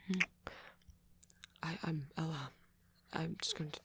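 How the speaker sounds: hesitant, stammering speech with long pauses; shy, flustered date. Acted. Flustered host welcoming a date to movie night, shy and halting. Acted shy roleplay, then plain outro.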